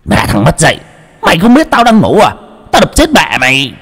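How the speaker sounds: startled father swearing, pitched high; angry cursing. A narrator's reading. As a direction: snap awake and curse the boy. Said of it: "ông giật mình chửi động".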